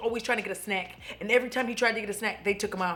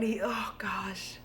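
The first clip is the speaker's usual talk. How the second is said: overwhelmed whisper, slow; hushed and choked up; 3 semitones lower, 7 dB quieter, slower than usual. Real, not acted. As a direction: breathe it almost soundless. Overcome by memory.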